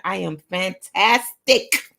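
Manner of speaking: "fantastic" drawn out and punched; "fantastic" as a big, stretched finish; emphatic affirmation. Shown as a demonstration. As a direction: hit every syllable of "fantastic", loud and slow. Hyping up affirmation list.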